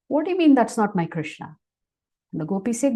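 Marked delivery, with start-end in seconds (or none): 0.1-1.6 higher, louder puzzled protest; more puzzled. Acted. Puzzled mother answers the gopis' claim, baffled and protesting. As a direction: question it in mild, baffled disbelief. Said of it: "She gets even more puzzled".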